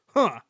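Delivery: loud, high, dismissive "huh"; short haughty huff; scornful grunt. A narrator's reading. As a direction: grunt with contempt. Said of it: "grunted Sir Handel".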